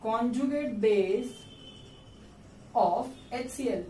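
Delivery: conjugate base of HCL, drawn out word by word; teacher modelling the name, very slow; slow reading aloud. Shown as a demonstration. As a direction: say it slowly like a dictation. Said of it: "ऐसे पढ़ा जाता है".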